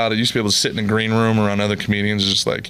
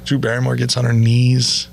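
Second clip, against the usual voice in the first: normal talking voice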